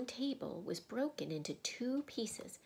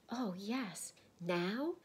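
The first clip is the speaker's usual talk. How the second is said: eager; 2 semitones higher, much slower than usual. Acted. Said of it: "clapping her hands".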